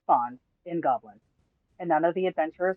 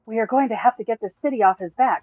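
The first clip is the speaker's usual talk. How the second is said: rallying call; 6 semitones higher, 8 dB louder than usual. Acted. Booma rallies the group.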